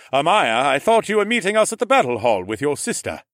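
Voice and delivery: high, loud big-brother voice; booming brotherly reproach; chiding lord. A narrator's reading. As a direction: call her out loudly across the group. Lord chides his little sister.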